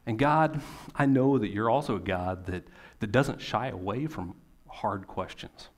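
steady, earnest prayer voice; closing prayer. Real, not acted. Preacher praying for his congregation, earnest prayer. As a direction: pray it simply to God, unhurried.

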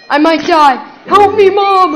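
high, loud wail; panicked cry for help. Real, not acted. Losing gamer calling to mom, panicked yell. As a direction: yell out for rescue.

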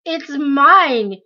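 cling to the purse and yell; shouting. A narrator's reading. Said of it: "he kept shouting".

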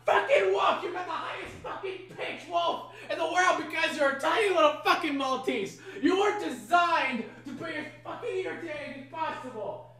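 high-pitched yelling through laughter; furious rant breaking into laughs; real yelling, laughing. Real, not acted. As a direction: scold the dog half laughing. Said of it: "yelling this loudly".

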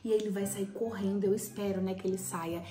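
low, soft secret plotting; hushed voice. Real, not acted. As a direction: keep it hushed so he can't hear. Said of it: "fala baixo".

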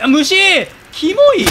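high, loud shriek of disgust at bugs. Real, not acted. Player spotting giant bugs in the game, a loud disgusted shriek. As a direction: squeal it out like a bug just jumped at you.

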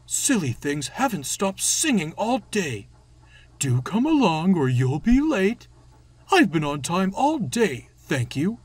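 high, loud, exasperated engine voice; fuming big engine. Acted. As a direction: rant crossly about the noisy coaches.

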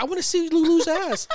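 eager fans. Retold or imitated. People demanding to see her.

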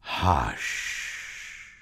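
low hush with a long, airy "sh"; calming hush. Acted. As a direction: still the storm with one drawn-out hush. Jesus calms the storm.

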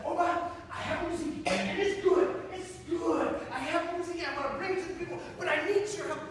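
high, eager pleading; a boy thrilled to tell the king his music; high, excited rush of words. Acted. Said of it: "he said".

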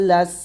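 লাস ending in a prolonged airy স; breath release. Shown as a demonstration. Teacher showing students hams, লাস with released breath. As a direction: let the air hiss out on the স of লাস. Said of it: "বাতাস বের করবেন".

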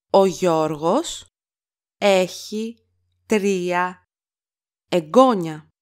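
each word set apart by pauses; slow word-by-word repeat. Shown as a demonstration. Teacher to learners, slow word-by-word model. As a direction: spell it out slowly, word by word. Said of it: "teacher repeats word by word".

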